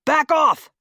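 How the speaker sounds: sharp yelled command; yelling. Acted. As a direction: bark the warning out. Urgent warning on ice.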